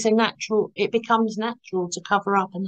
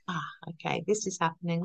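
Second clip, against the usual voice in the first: much softer, a hushed aside to herself; quiet self-realisation; 3 semitones lower, 9 dB quieter than usual. Retold or imitated. Catching herself masking mid-conversation, hushed inner aside. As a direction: murmur it as if noticing something inside.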